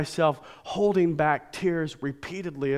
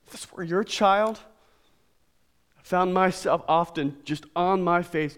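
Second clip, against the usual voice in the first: ordinary delivery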